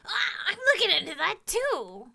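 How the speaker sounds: high, surprised kobold voice. Acted. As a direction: perk up like an eager kobold. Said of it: "I can't really do a different sounding kobold voice".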